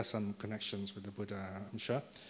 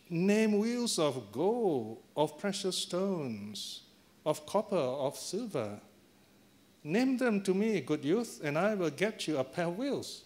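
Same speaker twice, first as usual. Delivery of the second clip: high, stretched-out happy proclamation; happy declaiming; 6 semitones higher, slower than usual. Acted. Happy Brahmin makes the boy an offer, high happy declaiming. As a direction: proclaim the offer grandly, drawing out words. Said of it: "this Brahmin is very happy".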